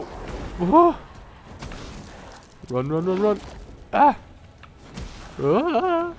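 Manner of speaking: high panicked yelps; yelling while running away. Real, not acted. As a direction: yelp while fleeing in panic. Fleeing enemy.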